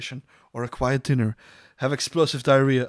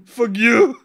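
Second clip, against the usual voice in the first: playful yelp; 10 semitones higher, 11 dB louder than usual. Pretend. Throws back the pun on fugue.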